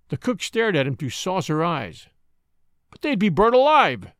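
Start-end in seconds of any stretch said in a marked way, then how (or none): 2.9-4.1 high and loud, panicked protest; wild objection. A narrator's reading. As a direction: blurt it out in alarm. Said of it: "he objected wildly".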